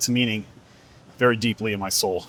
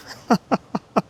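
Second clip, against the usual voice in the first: short high laugh; a quick real laugh; 11 semitones higher than usual. Real, not acted. Speaker recalling his wife's question, real laugh.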